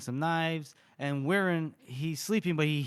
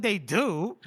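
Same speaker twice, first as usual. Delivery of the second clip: mock offended; 6 semitones higher, 5 dB louder than usual. Offended character retold.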